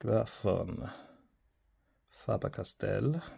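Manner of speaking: hammed-up German accent on the brand name; playful fake German brand read; mock posh German accent. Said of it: "Not sure what that accent was meant to be".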